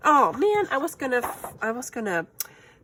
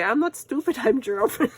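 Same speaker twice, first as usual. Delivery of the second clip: retold excuse; 5 semitones higher, 5 dB louder than usual. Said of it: "so I would always say".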